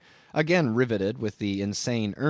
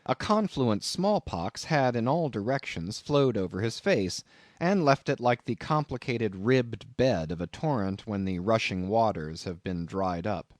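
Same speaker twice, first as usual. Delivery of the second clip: plain reading voice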